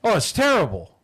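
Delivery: mock-sorry, high and loud gushing; exaggerated fake sympathy with pitch jumping up; sarcastic sympathy. Radio host mocks a judge over piled-up trash, loud sarcastic sympathy. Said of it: "I'm obviously being sarcastic".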